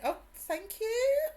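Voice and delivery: high, loud, stretched-out thank you; delighted thanks. Real, not acted. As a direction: squeal it slowly, flattered by the compliment. Streamer receives a compliment on accent.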